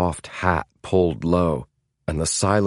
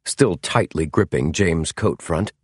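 ordinary delivery, nothing marked

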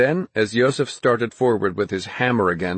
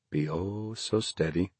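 much softer and slow, soothing; calmly; 10 dB quieter than usual. A narrator's reading. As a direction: murmur slowly to calm a hurt horse. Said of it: "the horse doctor said calmly".

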